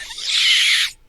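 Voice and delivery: very high, loud cracking yell; failed scream. Shown as a demonstration. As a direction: try to scream with a voice that gives out. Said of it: "I can't scream or do the thing".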